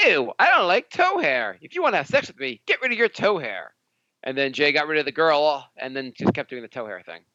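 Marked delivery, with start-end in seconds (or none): 0.1-3.7 put-on disgusted girlfriend. Host imitating a co-host's girlfriend who rejects toe hair, high mock disgust. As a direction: imitate a squeamish girl laying down terms. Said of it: "some girl who was like".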